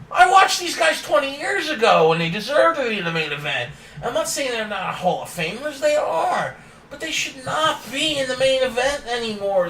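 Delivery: high-pitched, worked-up protest; exasperated rant. Real, not acted. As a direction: argue it hotly, voice pushed up high. Exasperated rant about aging headliners.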